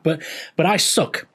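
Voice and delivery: normal talking voice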